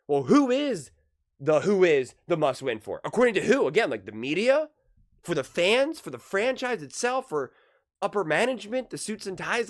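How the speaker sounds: challenging who the must-win is for; rhetorical challenge. Real, not acted. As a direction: push back with rising questions. Rhetorical questioning.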